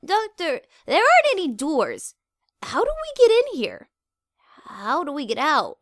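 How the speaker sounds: put-on young mare growing frightened; Ditzy panics, trapped without doors; starting to panic. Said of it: "starting to panic".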